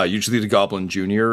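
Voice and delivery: normal talking voice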